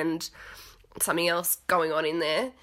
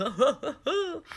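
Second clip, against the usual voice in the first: laugh; 8 semitones higher than usual. Real, not acted. Laughing after quoting Joe.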